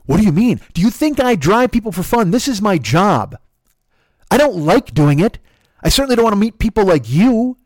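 loud, high, furious rant; mad tirade. Retold or imitated. Driver berating passenger for stealing the fare, mad tirade. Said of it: "I can't believe you're this mad".

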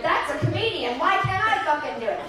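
envious would-be comic, mock outrage; indignant. Stranger resenting a comedian, indignant. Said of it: "there's a fucking hatred".

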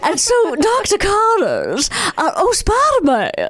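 high, slow, strained character voice; Doctor Connors voice. Shown as a demonstration. Mom reading Marvel stories to her son, high slow villain voice. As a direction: stretch it out high like a cartoon scientist. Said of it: "he talked like this".

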